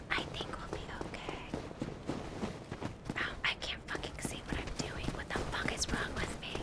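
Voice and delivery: unvoiced, very soft whispering. Real, not acted. Gamer sneaking past enemies, tense whispering. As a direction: whisper so nothing hears you.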